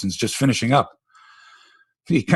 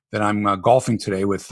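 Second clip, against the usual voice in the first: normal talking voice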